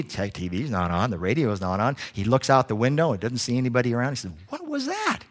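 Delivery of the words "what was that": high puzzled question; baffled by a noise. Acted. Husband puzzled, checking room.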